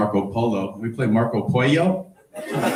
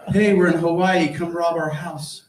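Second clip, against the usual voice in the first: high, airy ditzy voice; mocking dumb poster voice; 6 semitones higher, slower than usual. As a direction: play a clueless oversharer.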